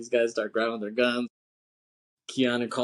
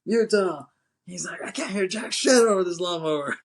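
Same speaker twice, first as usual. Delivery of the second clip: raised, higher-pitched retold complaint; mock calling over noise; 7 semitones higher, 4 dB louder than usual. Pappas over the lawnmower din, mock calling out. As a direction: strain to be heard, acting it out.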